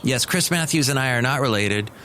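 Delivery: normal talking voice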